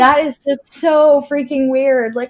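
high, drawn-out exasperated disbelief; incredulous. Retold or imitated. Former villain retelling her reaction to toe questions, incredulous.

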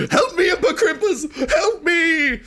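high, wailing cry for help; hammed-up high-pitched pleading; panicked Iggy. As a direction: call out in comic desperation. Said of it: "he's like".

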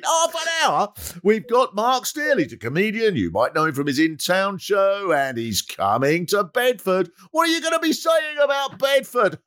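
high bright presenter patter; hammed-up local radio host. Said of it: "and I'm thinking".

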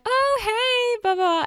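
very high, sing-out hello; imitated chirpy greeting. Strangers in line answer her greeting, in a high mock chirp.

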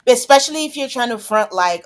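ordinary delivery

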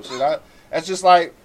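ordinary talk, nothing marked

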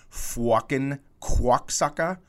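exaggerated Boston vowels with an added W; drawn-out Boston accent, rounding the vowels with a W. Shown as a demonstration. Showing how some Boston people talk, exaggerated Boston accent. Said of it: "some people have added a W".

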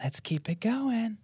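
a touch of slow eerie voice; softly slow like a faint ghost. Shown as a demonstration. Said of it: "Is it C".

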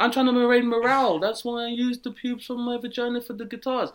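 high, airy mock Gwyneth; mock Gwyneth defending her pube guitars. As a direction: play her sweet and proud. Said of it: "she's like".

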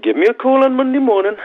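high, loud Geordie impression of a manager; a big Geordie voice; Geordie accent. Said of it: "that's my best Geordie accent".

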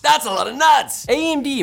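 high, loud exclamation; excited. Acted. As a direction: cheer like it's a big win. Wild exclamation after the nuts bit.

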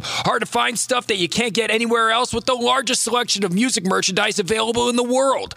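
upbeat radio ad voice; ad read. Acted. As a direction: sell it like a radio spot. Band merch ad read.

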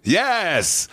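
put-on slow, high old-timey radio announcer; polished vintage radio announcer, drawn out; 1940s radio voice. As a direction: croon it like a 1946 radio host.

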